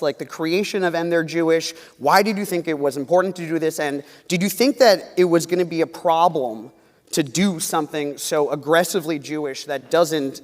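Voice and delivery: ordinary talk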